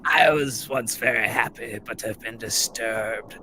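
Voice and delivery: quavering ghostly moan; faint spooky spirit voice; feeble ghost voice. Pretend. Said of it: "answered feebly".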